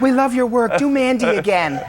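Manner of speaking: high gushing fan voice. Pretend. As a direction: fawn over him like a fan. Mock fan sucking up.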